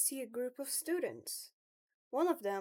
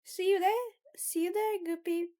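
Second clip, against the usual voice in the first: lightly voiced chatty student; a little higher and livelier; [name]; 8 semitones higher, 8 dB louder than usual. Club member sending the newcomer off to class, lightly raised chatty voice.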